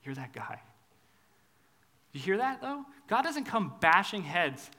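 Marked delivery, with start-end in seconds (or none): 0.0-0.6 low, soft, pointed; quiet accusation. Retold or imitated. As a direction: say it quietly and gravely. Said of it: "and he says".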